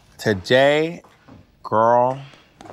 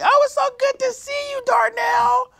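sassy drag queen. Retold or imitated. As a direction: shriek it like a gushing lady. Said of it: "She's like".

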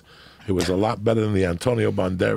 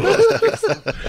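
squeaky high voice; 5 dB louder than usual. Retold or imitated. Comic ejector seat image.

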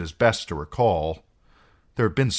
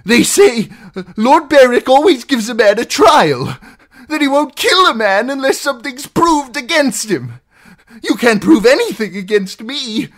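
high, loud frantic argument; desperate pleading; 13 dB louder than usual. Acted. Captive demanding trial from outlaws, desperate pleading. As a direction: argue wildly for your life.

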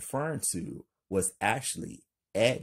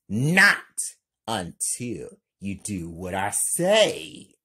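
yelling; 8 dB louder than usual. Acted. Lena threatening Kelly, loud commanding yell. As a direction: shout her down, then insist. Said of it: "Lena yells".

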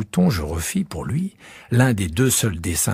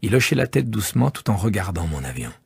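ordinary delivery, nothing marked

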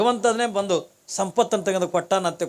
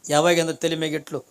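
ordinary talk, nothing marked

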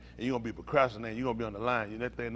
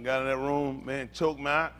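usual speaking voice

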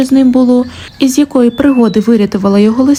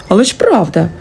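plain reading voice

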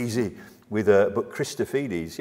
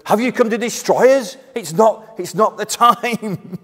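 loud, higher-pitched alarmed protest; demon's wonder and fear; 4 semitones higher, 10 dB louder than usual. Acted. Demon confronting Jesus before its doom, astonished and fearful. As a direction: cry out in astonished dread, pleading for more time. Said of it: "a note of wonder and fear from the demon".

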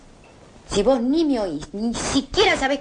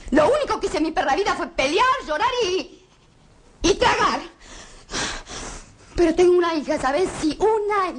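impassioned outburst; 8 semitones higher than usual. Acted. Impassioned outburst about her life.

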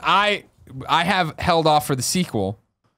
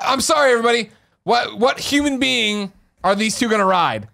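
high, loud exasperated appeal; comic outrage, each word stretched out; loud incredulity; 9 semitones higher, 3 dB louder than usual. Real, not acted. Mock outrage at co-hosts' pitch.

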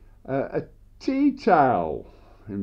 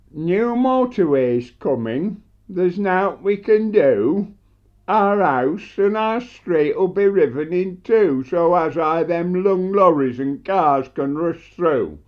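steady, lilting rhymed verse; dialect poem recital; 2 semitones higher, 3 dB louder than usual. Acted. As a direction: recite in a measured beat, landing the refrain.

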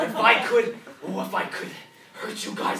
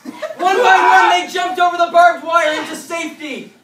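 triumphant narration; 6 semitones higher, 8 dB louder than usual. A narrator's reading.

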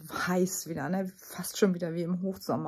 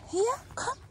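very high, rising coaxing call; animal call. Real, not acted. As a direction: lure it over in a high voice. Calling the escaped animal. (mit hoher Stimme lockend)

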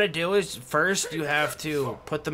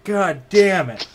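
loud slow curse; 5 semitones higher, 9 dB louder, slower than usual. Real, not acted. Exasperated outburst in game.

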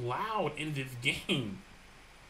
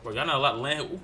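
normal talking voice

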